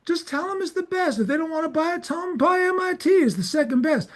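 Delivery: very high-pitched, insistent sales pitch; imitated lecture, high and emphatic; Walter Fields. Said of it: "He goes".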